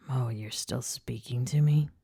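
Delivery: soft whispered tease; teasing whisper. A narrator's reading. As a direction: whisper it smugly.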